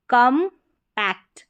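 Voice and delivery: Compact slowly as kam, pause, pact; slow teaching split of compact; split into syllables. Shown as a demonstration. Teacher splits word, then blends.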